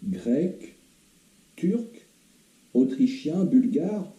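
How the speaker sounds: anxious questions, pitched high; instinctive fear. Acted. Patient guessing at the doctor's nationality, instinctively fearful. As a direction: ask warily, pitch lifting high on each guess. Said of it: "avec une frayeur intuitive".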